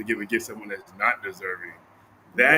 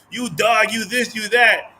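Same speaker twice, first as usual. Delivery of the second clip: high, loud mock insult; 9 semitones higher, 6 dB louder, slower than usual. Retelling a stranger insulting the student, loud mock jeer. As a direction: spit out the insult like a rude stranger. Guy insulting him, acted.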